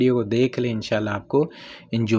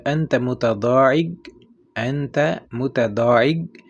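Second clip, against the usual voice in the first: slow, careful rising question; slow demo; slower than usual. Shown as a demonstration. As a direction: model it for learners. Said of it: "کیسے کہیں گے". (آہستہ سوال)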